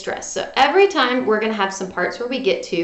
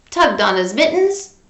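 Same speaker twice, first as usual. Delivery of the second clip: ordinary delivery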